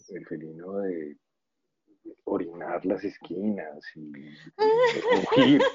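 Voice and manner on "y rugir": high, slow roaring cat noise; lion roar. Pretend. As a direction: roar it out like a big cat, laughing. Imitating a cat's roar.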